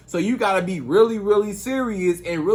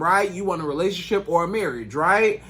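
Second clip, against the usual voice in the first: usual speaking voice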